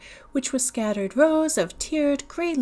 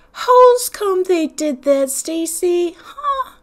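put-on high, slow whining child's question; upset little boy; 9 semitones higher, 8 dB louder, slower than usual. Little man asking Stacy why the bus did it, high and whiny. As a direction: ask hurt and bewildered.

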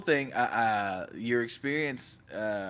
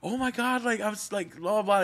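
excited; 9 semitones higher than usual. Retold or imitated. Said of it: "He's like".

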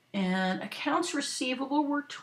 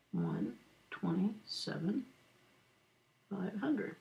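writing aloud; 10 dB quieter, slower than usual. Real, not acted. Teacher echoing the figure while writing it down, slow murmur. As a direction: murmur the figures slowly as you write.

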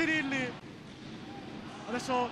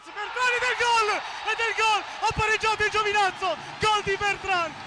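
breathless italian goal commentary; 4 semitones higher than usual. Real, not acted. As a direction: shout the goal with joy. Goal scored, commentator erupts.

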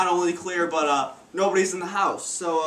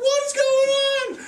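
wide-eyed and dazed, pitched way up; bewildered excitement. Acted. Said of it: "I'm like".

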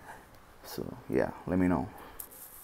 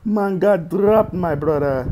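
put-on excited Dominican accent; 11 semitones higher, 12 dB louder, slower than usual. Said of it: "we using the Dominican accent today".

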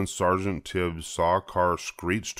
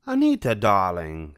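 cooing Cruella; 3 dB louder than usual. A narrator's reading. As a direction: purr it like a vain socialite. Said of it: "she cooed".

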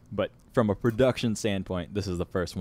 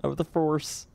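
Kermit voice; 7 semitones higher, slower than usual. Retold or imitated. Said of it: "It's like a Kermit".

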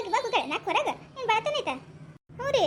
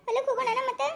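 crying voice; 4 semitones higher than usual. Acted. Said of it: "ಸರು ಯಾಕೆ ಅಳಾಕತ್ತಾಳ".

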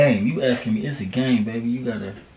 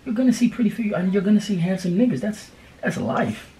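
soothing reassurance; 8 semitones higher than usual. Real, not acted. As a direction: ease her worry gently. Boyfriend reassuring crying girlfriend.

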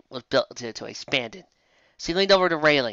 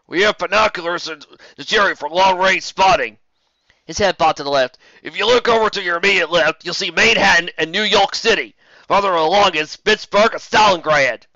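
radio sergeant; 5 semitones higher, 7 dB louder than usual. Acted.

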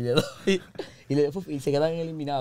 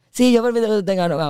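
put-on higher, louder voice for the girl; girl's answer; 5 semitones higher, 5 dB louder than usual. Girl agreeing he can have a girlfriend, put-on higher voice. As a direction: lift the voice to play her reply.

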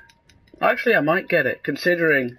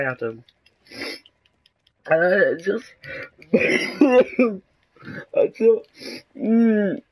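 choked, halting words jumping higher; trying not to cry; 5 semitones higher, much slower than usual. Pretend. Player complaining to Adam, mock tearful. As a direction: play at holding back tears, stammering. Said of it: "I'm trying not to cry".

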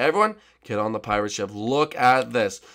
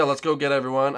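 ordinary talk, nothing marked

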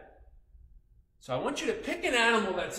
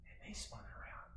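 whispered, much softer; 12 semitones lower, 21 dB quieter than usual. A narrator's reading. Storyteller whispering to the children how the animals copied him. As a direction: hush it like a secret.

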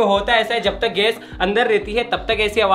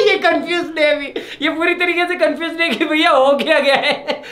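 high, amused laughing speech; real laughter breaking into the words; talking through laughter; 8 semitones higher than usual. Real, not acted. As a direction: crack up while explaining. Amused by the gas-altered voice.

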